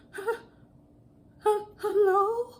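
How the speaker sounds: high, slow, timid hello in an imitated voice; frightened customer calling in, imitated timid voice; terrified caller. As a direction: play the scared customer hesitating on the phone. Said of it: "that lady was so terrified".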